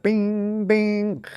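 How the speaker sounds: high beeping imitation; imitating dial-up beeps. Shown as a demonstration. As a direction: chirp it like a modem. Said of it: "you've heard the sound clip".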